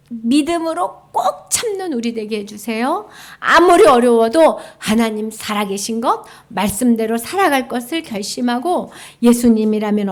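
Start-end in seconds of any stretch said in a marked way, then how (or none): none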